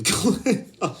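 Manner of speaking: words giving way to laughter; laughing mid-sentence. Real, not acted. Curious about what's going on, cracking up mid-sentence. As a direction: break into a laugh halfway through.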